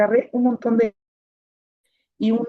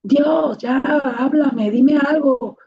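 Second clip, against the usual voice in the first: louder and higher, begging to be heard; desperate call heavenward, loud and raised; pleading shout to God; 5 semitones higher, 5 dB louder, much slower than usual. Retold or imitated. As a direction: cry out to the sky, dragging out the plea. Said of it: "Y yo así".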